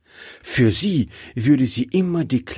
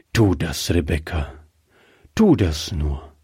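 lightly voiced old man, lower pitch; 6 semitones lower than usual. A narrator's reading. Old man lets his wife go, consenting. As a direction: give gentle consent as the old husband, pitched down. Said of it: "sagte der alte Mann".

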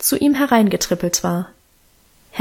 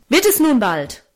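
angry father; 3 semitones higher than usual. A narrator's reading. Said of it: "rief er zornig".

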